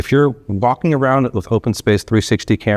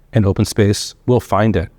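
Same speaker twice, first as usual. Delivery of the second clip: usual speaking voice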